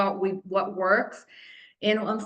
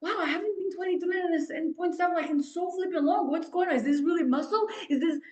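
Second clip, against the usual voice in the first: excited disbelief; 7 semitones higher, faster than usual. Retold or imitated. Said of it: "I'm like".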